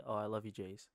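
tender. Shown as a demonstration. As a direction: say it gently and sincerely. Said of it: "another way to say".

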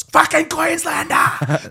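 high, drawn-out shout of state pride; yelled war cry. Acted. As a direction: bellow it at full voice, high and slow. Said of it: "shakes it and yells".

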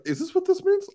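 put-on very high-pitched puzzled teen voice; unsure teenager. Imitating a teenager handed a responsibility, unsure and high-pitched. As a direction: squeak it like a clueless kid. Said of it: "if you give a teenager".

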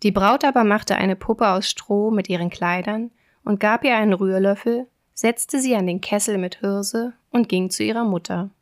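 plain reading voice

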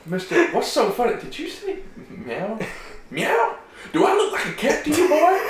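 high mock outrage, laughing through it; imitating Farva's angry cop. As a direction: mimic the cop's angry "Do I look like a cat" rant, cracking up. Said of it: "you get that whole meow".

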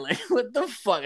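high, laughing disbelief; retold amused disbelief. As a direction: recount your shock through a laugh. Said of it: "I was laughing".

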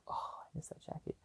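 softly whispered longing; whispered wistful aside. Real, not acted. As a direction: breathe it like a fond memory.

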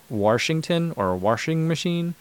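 "Washington" and "washing" with an R after the A, like "warsh"; added R. Shown as a demonstration. Showing viewers how Saint Louis people put R's on vowels, intrusive R. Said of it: "people put R's on vowels".

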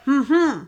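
imitated toothbrush mumble. Answering the roommate while brushing teeth, muffled humming. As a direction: answer as if your mouth is full of toothpaste. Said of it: "So I'm brushing my teeth".

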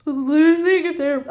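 words stretched by a yawn. Real, not acted. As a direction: talk through a real yawn. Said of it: "I'm, like, yawning during my own podcast".

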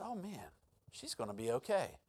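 hushed, low aside to self; quiet realisation. Retold or imitated. Preacher recalls sensing his aunt will recover, quiet realisation.

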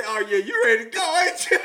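high, very loud mock hype; hyped-up retold reply. Groom retelling an eager reply to being ready, hyped-up. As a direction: crow it loudly in imitation.

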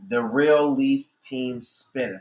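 spelling out a handle, word by word; slow, deliberate dictation; slow and clear. Real, not acted. Repeating the handle for listeners.

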